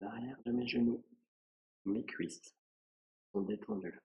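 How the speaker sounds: soft, unhurried meditation voice with long pauses; guided relaxation. Acted. Yoga teacher guiding a student through a relaxing body scan, softly and calmly. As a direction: lead a quiet body scan, phrase by phrase.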